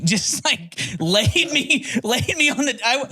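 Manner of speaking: high-pitched, cracking-up storytelling; laughing while talking. Real, not acted. Telling how he got knocked out cold, laughing helplessly. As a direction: tell it through your own laughter, pitch climbing.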